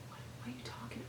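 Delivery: hushed, breathy mock reply; a quiet, mocking whisper of his brush-off; whispered retort. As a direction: whisper his dismissive comeback. Imitating his dismissive retort.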